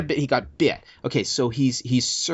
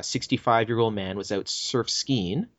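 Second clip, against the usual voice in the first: ordinary talk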